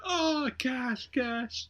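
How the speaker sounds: put-on high excited kid's voice; a kid spotting cash, high and eager. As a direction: squeal it like a thrilled kid. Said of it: "the kid's like".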